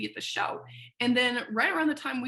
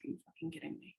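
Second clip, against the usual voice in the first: much softer, breathy angry disbelief; furious hiss; 16 dB quieter, faster than usual. Retold or imitated. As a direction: seethe it under your breath. Learning award rescinded.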